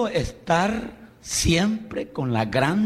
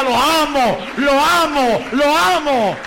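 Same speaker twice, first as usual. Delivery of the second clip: loud, high repeated declaration; declaring love aloud; 9 semitones higher, 6 dB louder, slower than usual. Shown as a demonstration. Preacher leading the congregation, loud high declaration of love. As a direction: call it out so the whole church hears.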